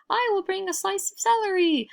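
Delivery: very high, small villager voice; eager villager. Acted. Villagers calling offerings, high eager voice. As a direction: pipe up like a little villager.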